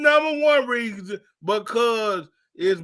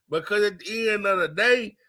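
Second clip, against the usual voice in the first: normal talking voice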